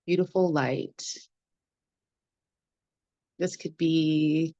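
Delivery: unhurried meditation guide with long pauses between phrases; guided meditation. Acted. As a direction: lead the group gently through the body, phrase by phrase.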